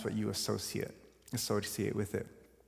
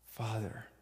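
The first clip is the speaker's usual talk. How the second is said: soft tender Father; 6 dB quieter than usual. Acted. Jesus's last tender cry, echoed.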